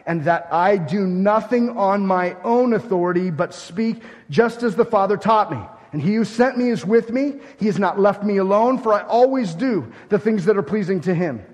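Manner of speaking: loud, high, emphatic scripture reading; forceful proclamation. Real, not acted. Reads Jesus' words at full force.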